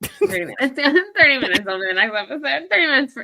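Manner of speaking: high, loud, laughing through the words; amused laughing. Real, not acted. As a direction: repeat it giggling at the slip. Cracking up at the time slip.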